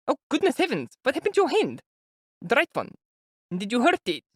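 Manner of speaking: high, anxious mother's voice; an older woman fretting before meeting her son; high, worried mother voice; fretful mother. A narrator's reading. Pulcheria chatters on.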